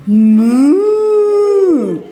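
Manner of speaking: big loud moo like a cow. Shown as a demonstration. Teacher models the cow sound.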